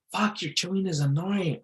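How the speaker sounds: high-pitched mock nagging woman; annoyed girlfriend. Girlfriend annoyed at his chewing, mock high female voice. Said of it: "and then she goes".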